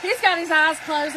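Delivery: ordinary delivery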